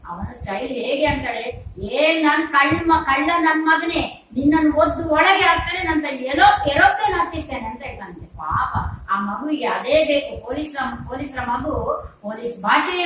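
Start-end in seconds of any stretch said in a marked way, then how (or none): 1.8-7.6 loud, higher-pitched mock cop tirade; police-style threat. Policeman father threatens baby like a thief, loud mock tirade. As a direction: rail at the culprit like a policeman. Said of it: "ಆಗ ಅವನದ್ದೇ style ಬರ್ತದೆ".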